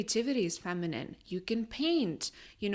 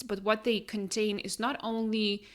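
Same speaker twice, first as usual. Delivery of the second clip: usual speaking voice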